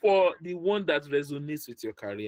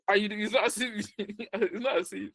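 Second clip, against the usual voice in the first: higher-pitched, breaking into laughter; laughing; 7 semitones higher than usual. Real, not acted. Job coach losing it mid-explanation, laughing. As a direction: try to talk on through the giggles. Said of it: "sorry that I'm laughing".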